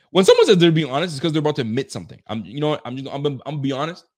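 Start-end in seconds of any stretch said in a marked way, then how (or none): 0.1-2.2 rant. Real, not acted. Ranting, loud.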